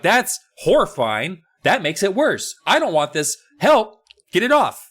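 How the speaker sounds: high, rising panicked cry for help; panicked old man. Retold or imitated. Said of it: "Steve is like".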